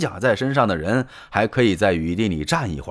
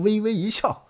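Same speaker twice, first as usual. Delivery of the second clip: ordinary delivery, nothing marked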